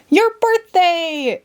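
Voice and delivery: slow, high, delighted cry; excited interruption. A narrator's reading. Tweedledee cuts Alice off, thrilled. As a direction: burst in thrilled. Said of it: "interrupted Tweedledee".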